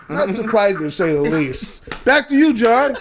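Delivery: mock TV anchor sign-off; imitating a newscaster, teasing. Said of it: "Why you putting your newscaster voice?".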